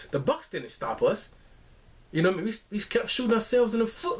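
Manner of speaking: ordinary talk, nothing marked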